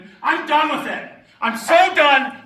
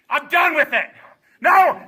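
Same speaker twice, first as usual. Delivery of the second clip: usual speaking voice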